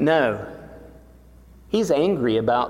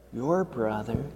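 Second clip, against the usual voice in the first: "your brother" dropped much softer; gentle softened echo; soft repeat; 7 dB quieter than usual. Acted. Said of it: "repeated softer".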